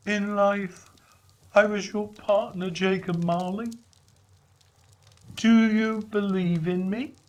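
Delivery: a mournful spirit laying claim; Marley's ghost. Acted.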